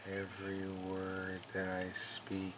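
slow, hushed hypnotist drone; playfully hypnotize the cat into sleep; mock hypnosis. Hypnotizing her cat Mookie, slow soft mock hypnotist. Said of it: "giving a hypnosis session to Mookie".

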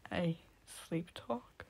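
slow soft puzzled mumble; mumbled. A narrator's reading. Narrator learns they sleep talk, slow soft mumble. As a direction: murmur it half-awake. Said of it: "I mumbled".

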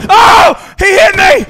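high, loud yelling at the game; raging gamer. Real, not acted. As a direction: scream in outrage at dying. Dying in the game.

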